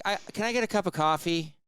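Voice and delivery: mock groggy plea; dazed patient asking. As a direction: ask like someone still under anesthesia. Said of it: "And she's like".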